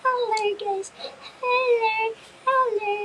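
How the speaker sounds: very high, squeaky greeting; tiny high-pitched hello; put-on high voice.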